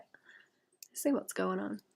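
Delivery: hushed, slow knowing whisper. Real, not acted. Whispering a teasing aside to her chat. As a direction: breathe it out like a secret.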